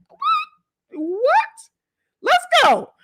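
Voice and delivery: high, excited "What? What?"; hyped reaction. Real, not acted. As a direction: jump up hyped, daring them on. Host reacts hyped to threat.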